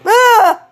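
loud high startled scream; a real jump-scare scream; sudden scream. Real, not acted. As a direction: shriek out in fright. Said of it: "Headphone warning".

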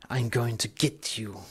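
put-on low, very quiet Russian accent threat; hushed, amateur Russian accent. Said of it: "You have to excuse my Russian accent".

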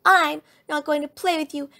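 high, loud outburst from a cross cub; angry shout. A narrator's reading. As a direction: declare it furiously to a playmate. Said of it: "shouted Sister".

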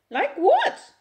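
sudden high yelp; startled mother yelps at the police. A narrator's reading. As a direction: yelp it out in alarm. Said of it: "Esther hears her voice yelp".

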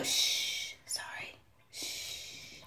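hushed whisper, no voice; soft unvoiced hushing. Real, not acted. Hushing toddler suddenly.

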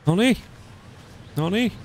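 pleased high exclamation; rising yelp of delight; excited noniin. Real, not acted. Reacting to game event.

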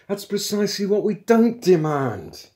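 high, loud exasperated correction; irritated. A narrator's reading. Intruder setting his muddled partner straight, irritated. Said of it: "exclaimed Magic Thighs in irritation".

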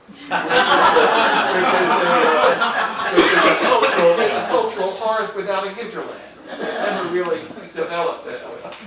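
laughing at his own joke about New York. Real, not acted. Laughing while describing New York.

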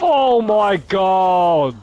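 long drawn-out shocked scream. Real, not acted. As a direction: stretch it out in shock.

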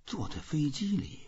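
ordinary delivery

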